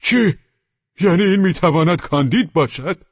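high, loud cry of disbelief; astonished shout. A narrator's reading. The other convict recognises Candide, a loud astonished shout. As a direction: shout it out, pitched well up. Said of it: "دیگری فریاد زد".

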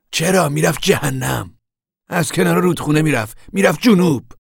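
high, loud, exasperated answer; heated retort. Acted. Al answers his sister about Connie, heated and loud. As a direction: fire it back loudly and high.

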